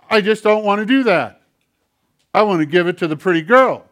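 naughty hand puppet talking back; defiant puppet hand. Acted. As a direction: play the stubborn puppet. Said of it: "it goes".

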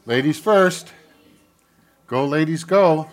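loud cheerful call. Real, not acted. Calling children out.

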